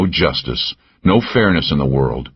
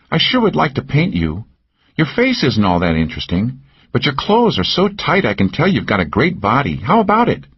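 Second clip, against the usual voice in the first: higher, teasing woman's voice; Cal's voice; 8 semitones higher than usual. A narrator's reading. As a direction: needle him with a pitched-up lilt. Narrator voices Cal.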